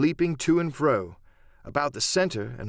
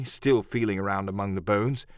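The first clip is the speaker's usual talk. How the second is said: ordinary delivery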